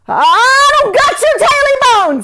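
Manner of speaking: very high, loud panicked denial; terrified protest. Acted. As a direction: plead in fear. Jake terrified reply.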